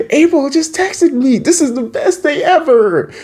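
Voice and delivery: very high, squealing delight; hammed-up overjoyed squeal; mock giddy excitement. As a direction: gush like someone with a crush. Acting thrilled reaction to April's text.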